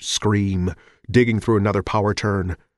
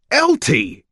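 screaming; 8 semitones higher, 4 dB louder than usual. Acted. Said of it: "he screamed".

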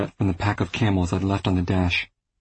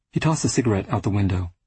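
ordinary delivery, nothing marked

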